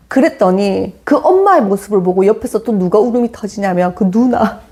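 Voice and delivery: ordinary talk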